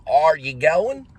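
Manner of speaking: "Are" with a drawn-out, overdone Texan R, high and rising. Shown as a demonstration. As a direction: stretch the R in "Are" slowly, Texas style. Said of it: "it, like, rings wrong".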